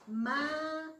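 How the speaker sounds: MAYO with a long, loud, held MA, then short yo; stress on MA. Shown as a demonstration. Teacher showing the tonic syllable, drawn-out stress on MA. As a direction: stretch out the first syllable of mayo. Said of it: "La sílaba tónica es".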